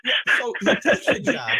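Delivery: breathy laugh through the words. Real, not acted. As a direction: laugh it out, still amused. Laughing after co-host's joke.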